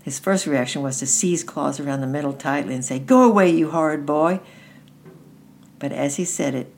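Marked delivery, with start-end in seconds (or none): 3.0-4.4 angry cry. A narrator's reading. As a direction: cry out to drive him off. Frightened child sends bully away.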